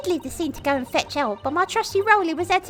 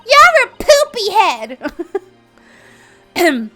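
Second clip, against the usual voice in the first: put-on childish yell; 3 semitones higher, 12 dB louder, slower than usual. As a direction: yell it like a bratty kid. Childish insult to prosecutor.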